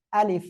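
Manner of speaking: আলিফ with a light puff of air on the final f; teacher's model আলিফ, airy final f; articulation demo. Shown as a demonstration. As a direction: say আলিফ carefully, releasing a little air at the end. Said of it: "হালকা বাতাস বের করে উচ্চারণ".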